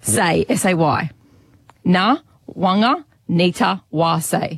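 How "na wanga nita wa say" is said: Na, Wanga, Nita, Wa, Say as separate chunks; phonetic, piece by piece. Shown as a demonstration. Co-host teaches presenter the name, piece by piece. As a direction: sound out the name with a gap after each piece. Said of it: "each of those words phonetically".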